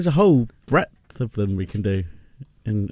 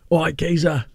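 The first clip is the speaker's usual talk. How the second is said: put-on Cockney accent; 4 semitones higher than usual. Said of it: "London, Cockney".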